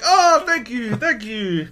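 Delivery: high-pitched broken-English gratitude; mock-grateful broken English. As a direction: imitate a relieved foreigner, voice way up. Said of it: "it's super broken English".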